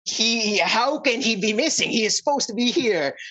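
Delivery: acted dismay, pitch lifted throughout; disbelief. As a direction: question it in alarmed disbelief. Townsfolk react to missing person.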